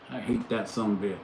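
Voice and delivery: ordinary delivery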